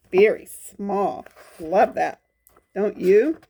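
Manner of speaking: usual speaking voice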